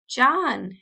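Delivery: urgent calling cry; cried out. A narrator's reading. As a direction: call out a name into the wind. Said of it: "she cried".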